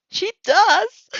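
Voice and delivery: loud high cry; exclaiming. Acted. Mina answering for Uraraka, loud and high. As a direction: shout it out gleefully.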